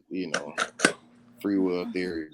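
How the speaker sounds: ordinary delivery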